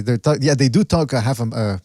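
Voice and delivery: normal talking voice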